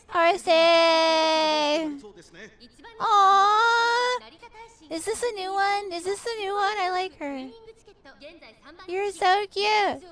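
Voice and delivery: put-on baby talk. Gushing over a new horse.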